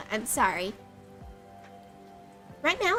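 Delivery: ordinary delivery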